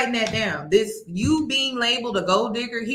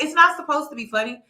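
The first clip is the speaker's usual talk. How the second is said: usual speaking voice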